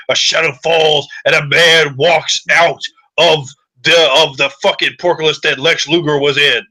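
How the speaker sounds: normal talking voice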